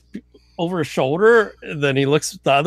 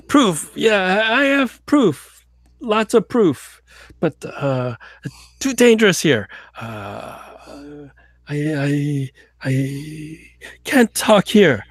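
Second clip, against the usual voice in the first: put-on drunk Russian agent; slower than usual. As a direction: wave it off, nervous and tipsy.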